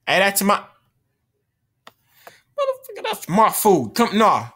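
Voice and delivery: furious shout at the player stealing his food; angry yell. Real, not acted. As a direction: bellow it at the thief.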